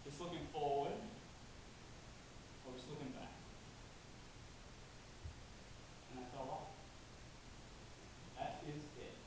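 low, hushed reflection with long pauses; subdued, pensive murmur; quiet musing. Acted. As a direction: think aloud softly, letting each phrase hang.

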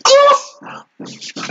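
very high, near-tearful squeal; overcome, voice going up and up; choked-up fan squealing with emotion; emotional squeal. Real, not acted. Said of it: "Estoy llorando de emoción".

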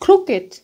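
crooked said as CROOK-id; high first syllable in "crooked", then a short -id; -ed as separate syllable. Shown as a demonstration. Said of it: "mình sẽ bật ra là ật".

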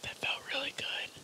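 soft ASMR whisper. Real, not acted. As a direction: whisper close to the mic. ASMR eating video.

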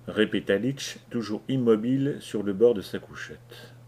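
ordinary delivery

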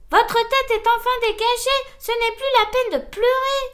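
put-on high, loud call to a crying baby; loud scolding.

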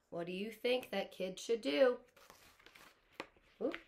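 usual speaking voice

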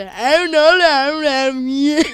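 whiny panicked mumble; mock whining. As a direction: whine like a sore loser. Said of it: "psikolojisi bozulduktan sonra böyle". (sızlanan taklit ses)

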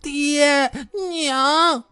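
high, drawn-out pitiful cry; miserable wail. A narrator's reading. The little Taoist girl running after them, high pitiful wail. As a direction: wail after your parents. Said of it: "一边跑一边凄惨地喊".

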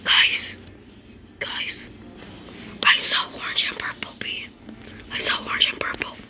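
hushed secret whisper to viewers. Real, not acted. Secretly telling viewers about imposter.

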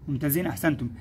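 ordinary delivery

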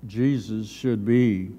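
ordinary talk, nothing marked

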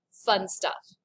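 ordinary talk

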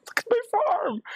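high squeal through laughter; very high, laughing outburst; laughing dismay. Real, not acted. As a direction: laugh and cry out in mock despair. Laughing in dismay at own farm.

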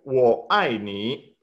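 Wo ai ni said slowly, each syllable spaced apart; slow Mandarin drill. Shown as a demonstration. Teacher slowly drilling Wo ai ni for the class. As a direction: spell out Wo ai ni syllable by syllable. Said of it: "Va de nuevo".

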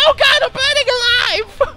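burning scream. Retold or imitated. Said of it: "Diddy Kong was like".

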